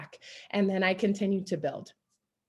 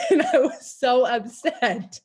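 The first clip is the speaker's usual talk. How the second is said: laughing; 4 semitones higher, 6 dB louder, slower than usual. Real, not acted. Laughing at her own story.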